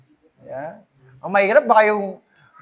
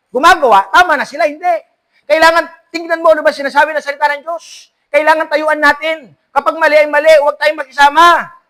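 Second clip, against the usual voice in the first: raised, higher, louder pulpit voice; fiery preaching; 6 semitones higher, 5 dB louder than usual. Acted. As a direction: drive the warning home to the congregation. Preaching at full force.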